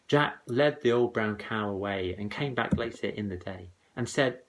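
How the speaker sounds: ordinary delivery, nothing marked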